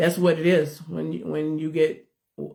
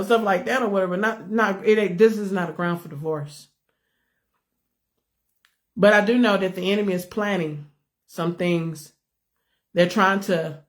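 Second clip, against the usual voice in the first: ordinary delivery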